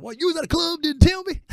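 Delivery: high, squeaky mock ex-wife voice. Comedian mocks his ex catching him at a club, high mock whine. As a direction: whine it like a nagging ex. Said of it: "my ex talking about".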